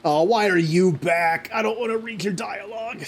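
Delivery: hammed-up reluctant moan; mock whining. Groans at returning game character.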